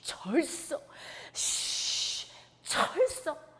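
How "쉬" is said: unvoiced hissing gust of wind. Storyteller making the wind's hiss for children. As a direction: whoosh like a storm wind over the sea.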